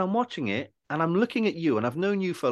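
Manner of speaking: high, animated retold explanation; earnest insistence. Friend explaining his view to the actor, retold insistence. Mate explaining what he sees.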